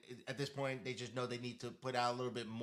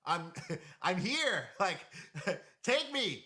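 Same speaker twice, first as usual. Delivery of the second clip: high, loud call-out broken by laughs; hammed-up pleading shout, high and amused; playful mock yell; 7 semitones higher, 6 dB louder, slower than usual. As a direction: yell for attention, half laughing. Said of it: "like, yelling at you".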